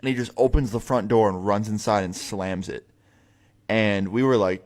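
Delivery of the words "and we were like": ordinary talk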